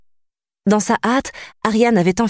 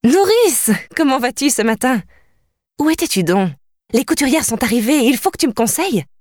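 put-on bright, higher young princess greeting her nurse; joyful greeting; 6 semitones higher than usual. A narrator's reading. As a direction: greet her warmly, lifted and happy. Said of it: "la salua joyeusement".

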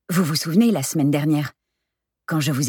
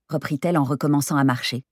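plain reading voice

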